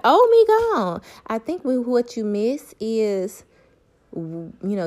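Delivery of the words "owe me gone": much higher and louder, scoffing; mock dismissive shout. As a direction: fling it back at him, scornful. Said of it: "I'm like".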